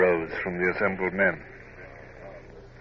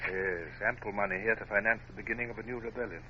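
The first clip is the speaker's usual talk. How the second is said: normal talking voice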